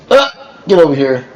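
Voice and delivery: sharp, high call across the room; calling the dog. Real, not acted. Calling the dog away from the wire, a sharp high call. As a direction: yank the dog back with your voice.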